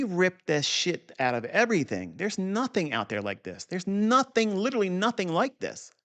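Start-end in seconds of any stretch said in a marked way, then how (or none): 3.6-5.9 emphatic boast. Real, not acted.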